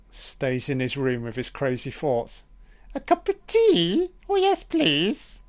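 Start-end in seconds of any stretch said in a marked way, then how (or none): 2.9-5.4 very high, squeaky put-on voice; high prissy mock voice, drawn out slowly; mock falsetto. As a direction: mince it in a silly falsetto. Mock voicing a character on screen.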